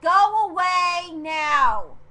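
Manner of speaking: real high, drawn-out shout; angry yell. Real, not acted. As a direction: yell at an intruding brother, very loud and stretched. Yells at brother interrupting recording.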